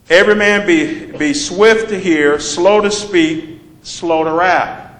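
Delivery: slow it down, leaning on slow; preacher spelling out the formula; slow emphatic repeat. Shown as a demonstration. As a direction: stretch out swift and slow. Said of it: "repeats formula slowly".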